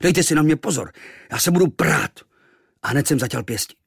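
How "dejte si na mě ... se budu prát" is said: high, loud indignant threat; enraged boy. A narrator's reading. Boy threatens sailors who mock him, angry. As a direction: square up to the bullies. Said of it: "Rozuřil jsem se".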